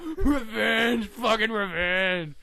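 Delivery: loud, high victory shout, stretched out; triumphant yell. Retold or imitated. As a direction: roar it like a winner. Lesnar gloating over beaten Mir.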